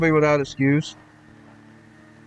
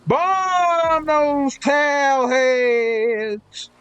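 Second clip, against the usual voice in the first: slow, drawn-out mock zealot preaching; mocking hypocrite believer; 3 semitones higher, much slower than usual. Mocking the hypocrites who say they have Jesus, slow and preachy. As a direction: ham up a smug believer who thinks Jesus excuses anything. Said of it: "that's what they think".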